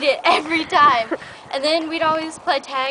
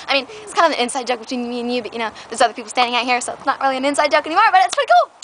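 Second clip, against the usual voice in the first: rushed aside; 4 dB louder, much faster than usual. Real, not acted. Rushing aside to friend.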